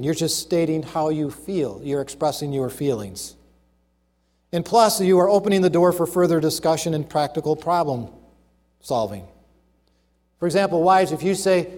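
usual speaking voice

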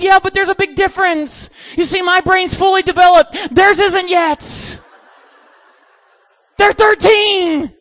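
high, loud acted protest; exasperated retort. Preacher arguing with campers over safety, mock exasperation.

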